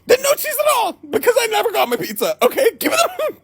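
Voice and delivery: high shouted demand for the pizza; shrieking at the driver, hammed-up fury; mock outraged shout. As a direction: yell it like a hungry hostage negotiator. Acted furious demand for pizza.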